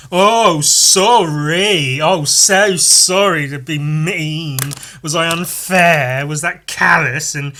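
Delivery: loud, slow, syrupy mock apology; sarcastic apology. Cursed man mock apologizing to his partner, loud and slow. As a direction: drag out the fake sorry, loud. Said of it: "cursed to always being sarcastic".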